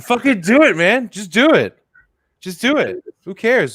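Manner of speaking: loud, high pumped-up encouragement; hype shouting. Real, not acted. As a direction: cheer him on at full volume. Egging his friend on.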